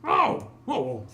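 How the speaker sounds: high, loud cry of realization; big dawning "oh"; startled dwarf constable. Acted. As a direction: exclaim high, then let it drop. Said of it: "like, the constable".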